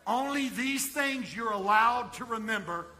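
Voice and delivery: loud, high, impassioned preacher; full-force preaching. Acted. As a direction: thunder it out to a big congregation.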